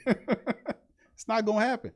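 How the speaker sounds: laughing. Real, not acted. Laughing at absurd idea.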